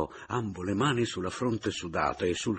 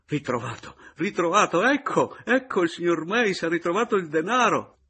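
loud, high, jubilant cries; exultant announcement; 11 semitones higher, 7 dB louder than usual. A narrator's reading. Caporale announcing the find to the family, exultant. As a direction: burst in announcing great news. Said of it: "ad annunziare esultante".

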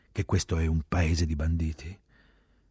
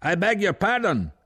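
high, loud, slow shout; officer's full shout; 7 dB louder than usual. A narrator's reading. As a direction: yell it out slowly over the engines. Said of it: "L'ufficiale gridò".

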